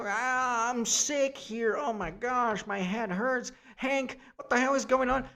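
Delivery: mock sick complaint. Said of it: "Jean Grey one".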